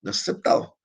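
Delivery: no es aceptado, whispered; whispered emphasis. Shown as a demonstration. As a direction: breathe the point out in a whisper. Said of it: "no es aceptado por la Real Academia". (susurrado con énfasis)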